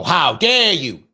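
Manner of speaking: slow, loud How dare you, imitating; mock outrage. Lonely friend rebuffs the question, mock outraged. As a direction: ham up the insulted friend. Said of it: "They go".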